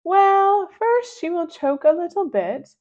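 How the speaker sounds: gleeful schemer; Mister Nuggy's voice. Acted.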